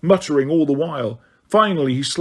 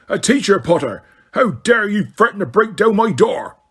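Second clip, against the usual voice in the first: roaring Hagrid; 7 semitones higher than usual. A narrator's reading. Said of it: "he roared at Harry".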